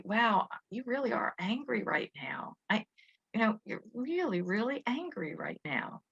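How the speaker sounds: put-on hushed, gentle whisper to an angry child; soft acknowledging whisper. Shown as a demonstration. Parent to angry child: soft whisper. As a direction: whisper it calmly, no edge. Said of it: "why don't you just say".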